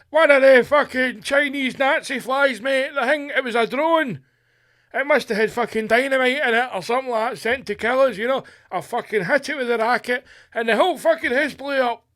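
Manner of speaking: put-on high, loud, fast ranting old man; a wild-eyed old man shouting his conspiracy at the police; hammed-up high ranting pensioner, yelling. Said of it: "Mister McGregor answers police".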